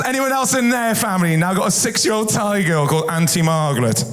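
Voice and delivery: ordinary talk, nothing marked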